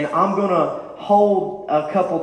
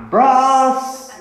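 high, loud call through the wall; aunt yelling from next room; calling out sharply; 9 semitones higher, 7 dB louder than usual. Retold or imitated.

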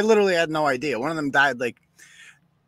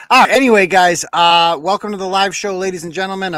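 louder show-host welcome; in front of an audience; 5 semitones higher, 6 dB louder than usual. Real, not acted. Host re-opens the live show.

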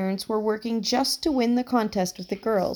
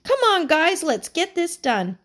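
urging friends; 8 semitones higher, 5 dB louder than usual. Acted.

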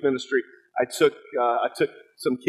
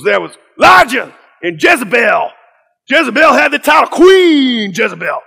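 Pastor Kevin Cosby; 10 semitones higher, 11 dB louder than usual. Retold or imitated. Pastor sweeping through the Bible for students, shouted preacher imitation.

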